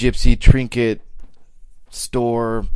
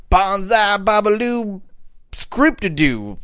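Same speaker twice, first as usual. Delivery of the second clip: slow, high, grandly drawn-out; mock fancy name; 9 semitones higher, slower than usual. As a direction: announce it like something ridiculous. Mocking silly beer names.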